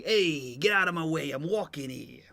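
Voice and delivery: lightly voiced New York tough guy; a hint of a New York cab driver; New York accent. Quoting the typical New York line, light New York accent. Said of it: "a typical New York".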